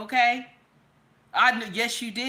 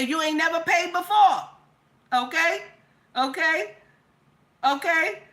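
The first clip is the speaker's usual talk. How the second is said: shouting; 5 semitones higher than usual. Real, not acted. Hyping the audience.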